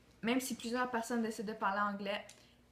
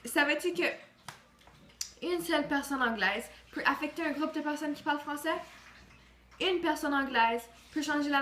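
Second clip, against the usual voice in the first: louder, higher rallying address; rousing speech; 5 semitones higher, 5 dB louder than usual. Acted. Student rallying listeners to defend French, rousing. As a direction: stir the crowd like a rally speaker.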